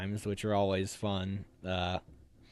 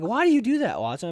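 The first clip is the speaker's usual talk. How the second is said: high, curious questioning; mock puzzled recruit; 10 semitones higher, 4 dB louder than usual. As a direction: imitate the other recruits asking, raised pitch. People questioning him, retold.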